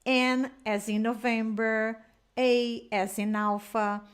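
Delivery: slow, letter by letter spelling; slow spelling demo. Shown as a demonstration. Teacher repeating her spelling example slowly. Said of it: "Por exemplo".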